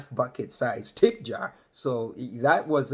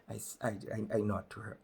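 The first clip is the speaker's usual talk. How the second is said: ordinary talk, nothing marked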